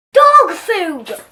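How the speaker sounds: high, loud shocked shriek; piercing shocked shriek of disbelief. A narrator's reading. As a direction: shriek it so three aisles hear. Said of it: "shrieked the manager in a voice that could be heard three aisles away".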